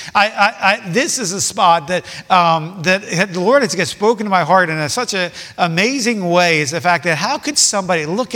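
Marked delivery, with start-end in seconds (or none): none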